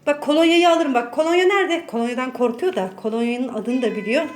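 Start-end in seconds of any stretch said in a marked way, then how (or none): 0.1-1.9 loud, high playful threat; threatening the cat. Real, not acted. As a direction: warn the cat you'll get the cologne. (kediyi yüksek sesle tehdit ediyor)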